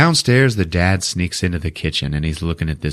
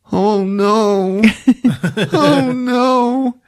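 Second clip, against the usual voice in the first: high-pitched hammed-up oh no; mock wailing dismay; 14 semitones higher, 4 dB louder, much slower than usual. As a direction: wail like a dismayed kid. Mimics Ralphie finding broken glasses.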